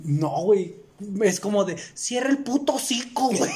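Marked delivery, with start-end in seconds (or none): 1.9-3.5 annoyed. Retold or imitated. Quoting his reaction to ASMR videos.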